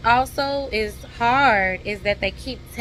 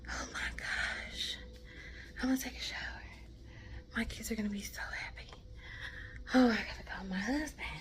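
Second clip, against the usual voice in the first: soft, breathless private whisper; overwhelmed whisper; 15 dB quieter, faster than usual. Real, not acted. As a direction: whisper your relief to yourself.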